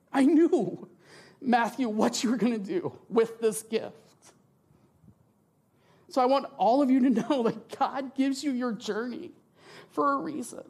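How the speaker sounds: high, tearful and breaking; fighting back real tears; choked up while testifying. Real, not acted. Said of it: "Sorry, I'm crying".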